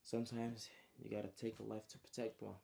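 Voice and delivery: softly spoken acted line; muttered, soft voice. Trey after killing the man, soft mutter. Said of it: "in a soft voice".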